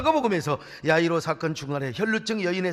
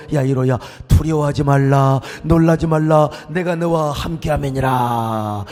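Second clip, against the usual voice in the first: high, loud, rising proclamation; preaching at full force; 4 semitones higher, 10 dB louder than usual. Acted. Preacher voicing Jesus comforting Jairus, building loud. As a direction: build to a thunderous call.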